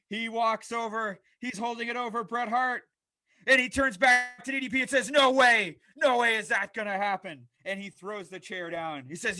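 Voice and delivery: lively ringside commentary, calling every move; wrestling play-by-play. Acted. Host calling his own wrestling show, energetic play-by-play. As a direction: call the match like a TV announcer.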